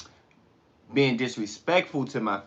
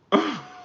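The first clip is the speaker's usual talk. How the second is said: high laugh. Real, not acted. Laughs at trolls.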